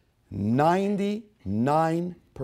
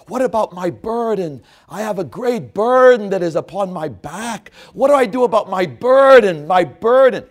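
louder and higher, a desperate pilgrim pleading; anguished pilgrim's cry; 8 semitones higher, 9 dB louder than usual. Acted. Pilgrim begging the guide for help with his burden, anguished loud cry.

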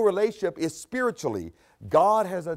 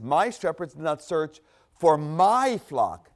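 "My" leaned on, slow and deliberate; stress on my. Shown as a demonstration. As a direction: hit "My" hard, slowly. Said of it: "not their flock, my flock".

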